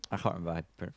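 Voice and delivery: ordinary delivery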